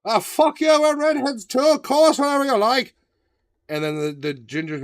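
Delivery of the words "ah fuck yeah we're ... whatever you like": put-on cheery Irish accent, higher and louder; hammed-up Irish lilt, eager to agree; mock Irish accent. The Irish backing the gingers, mock Irish accent. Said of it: "the Irish were like".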